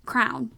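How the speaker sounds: crayon said as "crown", one syllable with an OW. Shown as a demonstration. Teacher quoting the version she doesn't get, crayon as crown. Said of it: "they pronounce it as".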